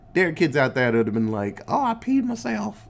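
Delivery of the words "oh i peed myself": put-on pitched up like a frightened little kid; imagined child admitting he peed himself, high mock voice; scared kid voice. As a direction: squeak it like a kid who got spooked.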